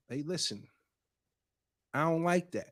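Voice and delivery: soft, measured retold objection; calm objection. Man retelling how the boyfriend told her, calm and quiet. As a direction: quote him gently setting a boundary, quietly. Quoting boyfriend calmly setting a boundary.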